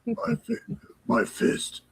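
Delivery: unvoiced raspy Batman whisper. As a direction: rasp the count like Batman. Batman parody.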